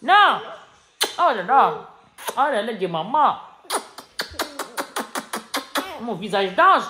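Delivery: loud playful exclamations; yelling across the room. Real, not acted. Calling out to the child.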